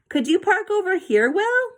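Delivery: high call across the enclosure. A narrator's reading. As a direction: call to Will across the field, pitch way up. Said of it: "David called".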